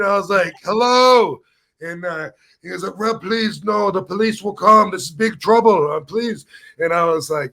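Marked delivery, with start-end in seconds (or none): 0.6-1.4 a much higher hello called into the dark; calling out. Retold or imitated. Rob calls into the dark restaurant, high and loud. 3.0-6.5 higher, frightened begging; scared pleading. Retold or imitated. Scared friend warning Rob about the police, pleading high. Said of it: "Martine was so scared".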